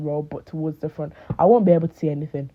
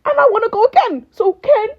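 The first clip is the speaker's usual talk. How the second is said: high, loud, fast outburst; loud mock plea; 6 dB louder than usual. Fan begging Ken for another show, loud mock plea. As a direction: cry it out high and insistent.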